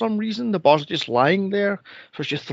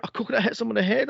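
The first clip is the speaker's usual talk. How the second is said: ordinary talk, nothing marked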